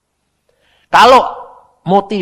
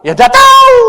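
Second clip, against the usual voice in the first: loud, very high yelp; high-pitched mock exasperation; clueless outburst; 15 dB louder than usual. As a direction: throw up a shouted comic answer. Acts out clueless answer.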